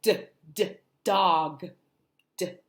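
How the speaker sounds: normal talking voice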